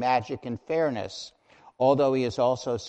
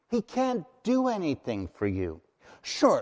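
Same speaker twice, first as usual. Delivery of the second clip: ordinary talk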